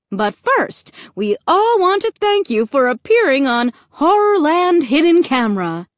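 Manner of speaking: big show-host announcement, high and loud; cheery MC. A narrator's reading. MC revealing the hidden camera show, cheery. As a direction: announce it like a game-show emcee.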